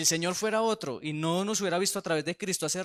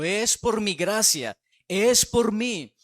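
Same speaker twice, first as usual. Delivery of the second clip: very slow, higher and louder on "es por mí"; slow solemn emphasis; 4 semitones higher, 6 dB louder, much slower than usual. Acted. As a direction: draw out "Es por mi gracia" with weight. (la voz de Dios, lenta y enfática)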